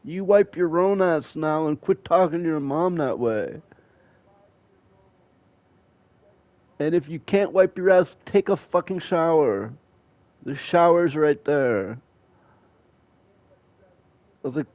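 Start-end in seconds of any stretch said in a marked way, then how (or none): 0.1-12.0 stern lecture. Retold or imitated. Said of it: "I said".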